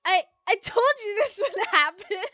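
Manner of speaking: high, loud laughing through the words; cackling friend who called it; laughing hysterically. Acted. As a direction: crack up as you speak. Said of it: "kept laughing hysterically".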